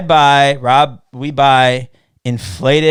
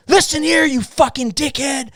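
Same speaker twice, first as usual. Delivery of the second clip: very high-pitched imitated voicemail rant; mock violent threat; 12 semitones higher than usual. Re-enacting his own voicemail to a heckler, mock violent. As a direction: re-enact an irate voicemail, voice pushed way up. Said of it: "all these violent voicemails".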